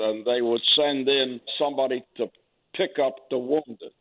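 usual speaking voice

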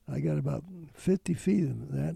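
ordinary delivery

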